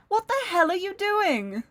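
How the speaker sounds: high, slow, furious demand; angry. Acted. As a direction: spit out each word in anger. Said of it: "Kano snapped".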